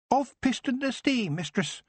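high, bright-spirited goblin introducing himself; chirpy little goblin; cheerful goblin. Acted. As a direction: goblin proudly giving his name. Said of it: "the goblin said cheerfully".

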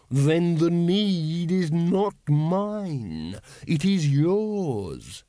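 very slow, drawn-out dragon voice; imperious dragon. A narrator's reading. Dragon addressing its cowed human servant, imperious. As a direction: draw every word out like a haughty dragon.

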